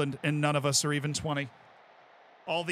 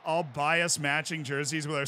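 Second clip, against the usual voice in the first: ordinary delivery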